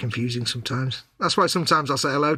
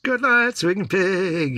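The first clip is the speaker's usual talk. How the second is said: calling goodnight; 5 semitones higher, much slower than usual. Real, not acted. Saying goodnight to a viewer.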